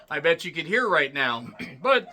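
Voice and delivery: normal talking voice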